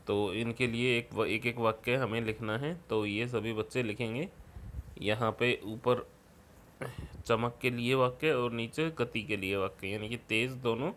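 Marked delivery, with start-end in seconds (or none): none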